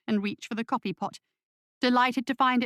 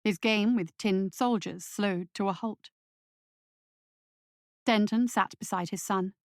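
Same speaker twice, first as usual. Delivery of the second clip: usual speaking voice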